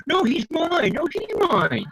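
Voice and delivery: high, whiny back and forth; childish squabble. Retold or imitated. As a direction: bicker like kids. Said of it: "very high school teenagers".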